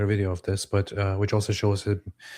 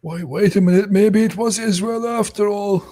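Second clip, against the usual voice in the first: high, loud put-on voice; hammed-up dawning shock; mock sudden realisation; 11 semitones higher, 5 dB louder than usual. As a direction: play a silly convert to the conspiracy. Pretends sudden conversion to conspiracy.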